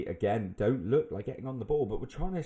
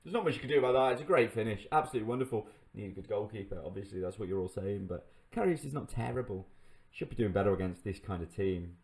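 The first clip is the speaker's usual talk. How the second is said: normal talking voice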